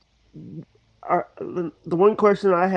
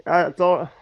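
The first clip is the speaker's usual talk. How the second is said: ordinary talk, nothing marked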